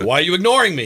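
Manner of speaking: put-on a rough go at a Boston accent; lightly voiced angry Bostonian. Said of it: "I wish I could do a better Boston accent".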